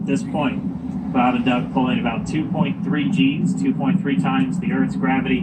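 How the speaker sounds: steady broadcast commentary; live launch commentary. Real, not acted.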